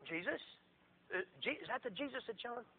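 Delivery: hushed, halting question; acted quiet amazement; stunned Herod. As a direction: drop to a soft, stammering double take. Herod stunned to hear who it is.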